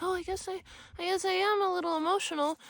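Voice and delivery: high, soft mocking voice of a patient giving in; lightly mocks a doubtful patient conceding; mock meek patient. Mocking a patient who gives in, high and soft.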